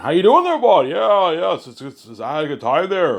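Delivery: very high, loud Canadian caricature; hardcore Canadian accent. Retold or imitated. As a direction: belt out a broad western Canadian accent. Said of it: "they have hardcore Canadian accents".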